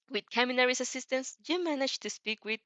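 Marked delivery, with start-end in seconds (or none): none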